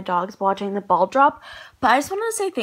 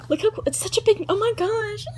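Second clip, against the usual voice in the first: gasping, high-pitched thrill; freaking out; 8 semitones higher than usual. Real, not acted. Vlogger shows viewers such a big number, freaking out. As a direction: marvel at it in a high, excited voice. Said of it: "I'm freaking out".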